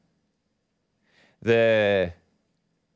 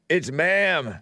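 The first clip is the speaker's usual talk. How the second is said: imitated meme; 3 semitones higher than usual. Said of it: "from the transgender guy at GameStop".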